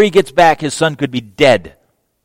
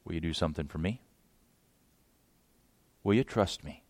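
much softer, lower, gentle turn-around; Jesus answering softly; Jesus quietly turns the question back; quiet reply; 7 semitones lower, 17 dB quieter, faster than usual. Retold or imitated. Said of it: "Jesus says".

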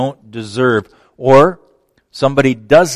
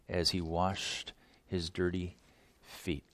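ordinary delivery

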